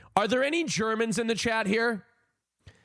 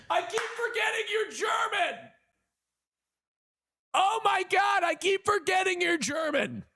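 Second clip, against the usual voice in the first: high, laughing cry of embarrassment; laughing exclaim; 12 semitones higher than usual. Real, not acted. Streamer squeals at forgetting chat is German.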